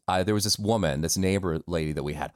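ordinary talk

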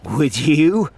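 slow, off-hand coworker voice with a silly hat; a rambling, self-amused office guy; chatty coworker voice, in a different timbre from the narrator; Frink, chatty coworker. Acted. Frink voice again.

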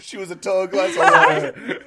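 hammy film noir narrator; noir detective. Pretend. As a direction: purr it like a hardboiled gumshoe. Noir dame description bit.

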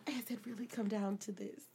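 low, very soft disbelief; inner thought. Retold or imitated. Woman reliving her proposal thought, quietly dismayed. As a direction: murmur it to yourself. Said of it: "I was like".

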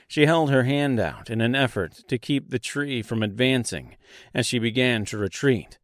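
plain reading voice

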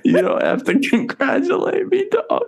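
very high mocking squeak; squeaky high voice. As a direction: push the voice way up, teasing.

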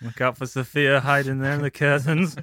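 loud sing-song panto warning; panto crowd callback. Pretend. Panto warning about hidden Sophia.

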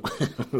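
short unvoiced chuckle; little airy laugh; soft breathy laugh. Real, not acted. Laughs at paradox.

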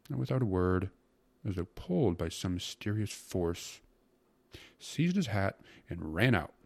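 plain reading voice

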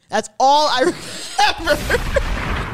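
high, loud, breaking into laughter; laughing hard. Real, not acted. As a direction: voice climbing into helpless laughter. Cracks up at her own blackout.

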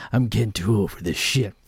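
low-pitched weary old cop impression; Danny Glover. Quoting Lethal Weapon to make his point, low impression. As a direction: drop your voice like a too-old action hero. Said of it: "Danny Glover was Lethal Weapon, where he's like".

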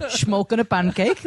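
slow, lightly put-on Dutch accent; a hint of a bad Dutch accent, drawn out; mock Dutch accent. Actor recalling his bad Dutch accent role, lightly put-on Dutch accent. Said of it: "done him as Woody Allen".

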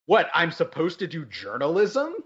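imitated indignant questioning; incredulous. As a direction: quote him as baffled by the very idea. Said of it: "he incredulously is like".

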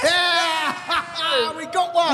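high, loud cheer with a laugh; excited shout. Real, not acted. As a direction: shout it out in delight. Car owner found in crowd.

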